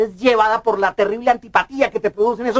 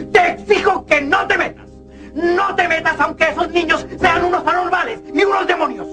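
shouting, forbidding her; raised angry voice; 5 semitones higher than usual. Acted. Father forbids daughter, angry quarrel.

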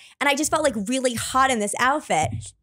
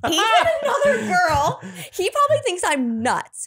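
high, half-laughing wail; mock mortified panic; 8 semitones higher than usual. Her seeing him with another girl, mock panic. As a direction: relive the embarrassment in a high panicked pitch.

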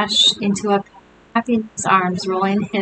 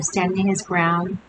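plain reading voice

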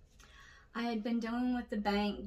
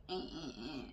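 low, soft grumbled "eh"s; bickering noises; 7 semitones lower, 9 dB quieter than usual. Retold or imitated. Describing friend's spat with Wilbur, grumbling noises. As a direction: imitate petty squabbling under your breath.

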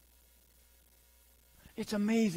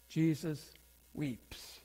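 very slow and much softer; a soft, slow comfort; tender hush; 4 semitones lower, 7 dB quieter, much slower than usual. Acted. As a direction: let it fall gently, almost breaking. Sermon's tender personal climax.

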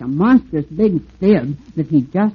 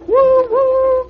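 very high, louder whistle hoots; official train whistle; 7 dB louder than usual. Acted. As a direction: toot it proudly like an engine. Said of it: "in his most official voice".

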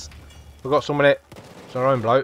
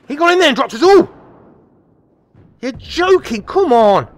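exasperated outburst; 9 semitones higher, 6 dB louder than usual. Real, not acted. Enemy wipes the squad.

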